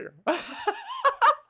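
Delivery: loud high burst of laughter; big laugh. Real, not acted. Vlogger laughing at own villain bit, loud laugh. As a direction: crack up at the silly voice.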